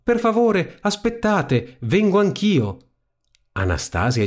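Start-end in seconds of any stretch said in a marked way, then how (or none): 0.0-2.8 high urgent call down the stairs. A narrator's reading. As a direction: beg them to wait, voice raised high. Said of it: "corse giù per le scale gridando".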